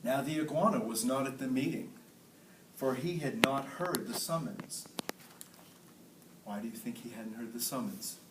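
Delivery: ordinary delivery, nothing marked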